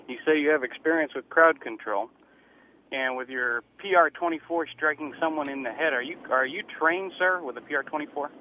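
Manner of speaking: ordinary talk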